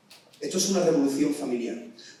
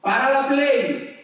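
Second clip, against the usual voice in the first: higher, louder, slow parent shout; parent yelling; 10 semitones higher, 7 dB louder, much slower than usual. Retold or imitated. Preacher acts a parent ordering the console off, loud yell. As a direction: call it out like a fed-up mum.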